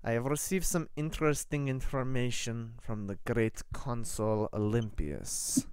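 measured Eastern European official; formal prelate with a Russian accent. Acted. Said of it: "at the Russian accent".

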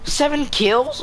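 high, stunned question; can't believe the number; amazed. Real, not acted. Amazed at teammate's kills.

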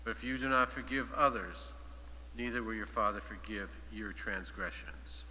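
ordinary talk, nothing marked

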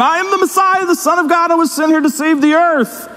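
high-pitched mock-messiah declaration; comically grand imitation, held high; grandiose proclamation. Patient claims to be Messiah.

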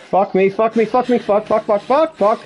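high, frantic repeated cursing; panicked. Real, not acted. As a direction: swear in a rising panic. Panicking as killer chases her.

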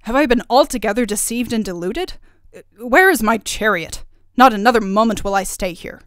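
loud, outraged king; angry. A narrator's reading. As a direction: demand to leave in a temper. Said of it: "cried the king angrily".

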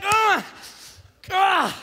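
high "ugh" of frustration; frustrated groans, repeated; exasperated groan. Acted. Dad fed up with his boys' excuses, exasperated.

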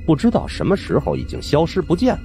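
usual speaking voice

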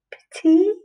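Petit lifted high and rising on the repeat; teacher sings Petit back up high. Shown as a demonstration. Teacher models the title for pupils, Petit high and rising. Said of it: "It's called".

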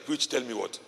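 usual speaking voice

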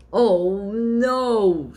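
loud, high dismayed wail; dismayed cry. Acted. Witches dismayed as book burns.

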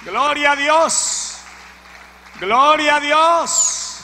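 loud, slow, stretched cry; fervent praise. Real, not acted. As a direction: lift the whole church with it. Preacher exclaims praise.

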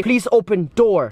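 Yorkshire style, "Please open door" with "the" left out; Yorkshire accent drop. Shown as a demonstration. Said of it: "they don't say "the".

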